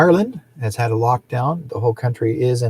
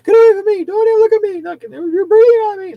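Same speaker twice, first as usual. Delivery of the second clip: much higher and much louder shrieking panic; mock panicked shopper; 9 dB louder than usual. Mocking masked shoppers who screamed at him to back off, a high loud mock shriek. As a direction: shriek it like a germ-terrified stranger. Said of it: "people who were manically crazy".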